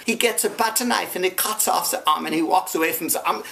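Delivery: ordinary delivery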